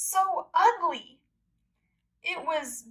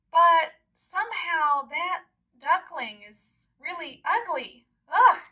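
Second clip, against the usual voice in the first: mean-spirited mocking voices; mocking ducks; 3 semitones higher, 5 dB louder than usual. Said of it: "They said".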